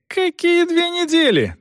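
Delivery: very high, indignant cry; outraged exclamation. A narrator's reading. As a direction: throw it back in a very high, outraged voice. Son explodes in protest.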